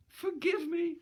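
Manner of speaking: put-on high pleading young woman's voice; begging girl. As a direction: beg in a high girlish voice. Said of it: "she begged".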